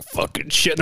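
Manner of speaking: hushed, breathy swearing; muttered curse. Retold or imitated. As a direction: swear under your breath. Tiger swearing under breath.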